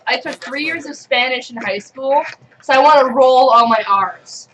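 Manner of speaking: usual speaking voice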